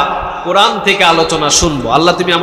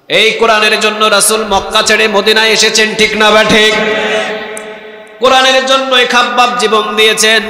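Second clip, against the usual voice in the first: rising preacher's call, higher and louder; preaching fervor; 5 semitones higher, 4 dB louder than usual. Acted. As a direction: build the sermon up with fervor. Preacher rising to full force.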